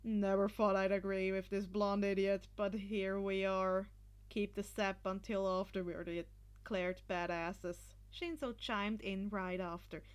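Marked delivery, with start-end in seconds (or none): 0.0-7.9 put-on low, flat-toned deadpan boy; dry grudging agreement. A narrator's reading.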